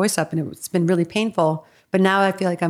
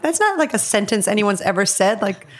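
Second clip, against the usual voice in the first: incredulous retelling; 2 semitones higher than usual. Retold or imitated. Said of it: "I was like".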